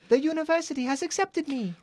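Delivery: put-on high, quick Italian-accented boy; hammed-up Italian accent, pitched right up; bad Italian accent. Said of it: "I'll do bad Italian".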